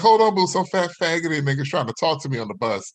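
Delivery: mock indignant complaint into the phone; imitated annoyed woman. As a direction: mimic a woman griping to her boyfriend. Woman complains to boyfriend about him.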